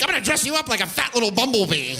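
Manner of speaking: put-on high, fast cooing baby talk; doting owner voice. Owner promising the dog a costume, high fast baby talk. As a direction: gush at the dog. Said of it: "now we're like".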